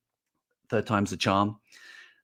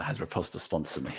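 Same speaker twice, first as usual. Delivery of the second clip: ordinary talk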